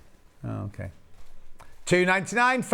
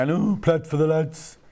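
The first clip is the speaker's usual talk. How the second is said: drawn-out imitation of a Wolverhampton accent; slower than usual. Presenter imitating absent Charlie's catchphrase, mock Wolverhampton accent. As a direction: lightly put on a Wolverhampton accent. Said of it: "in his Wolverhampton accent".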